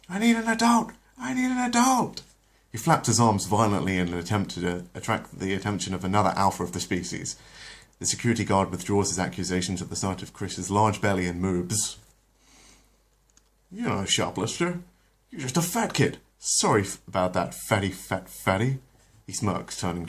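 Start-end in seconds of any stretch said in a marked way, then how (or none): 0.1-2.2 very high, loud terrified cry; a scared kid shrieking for help; panicked shriek. Acted. Chris panicking at the guard, shrieking very high. 13.7-18.8 mocking guard. Guard mocks Chris.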